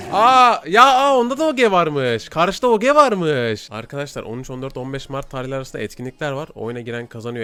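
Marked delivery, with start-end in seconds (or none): 0.1-3.7 loud, high startled exclamation; surprised shout. Real, not acted. Gamer spotting an enemy player, loud surprised exclamation. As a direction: blurt it out as the enemy appears.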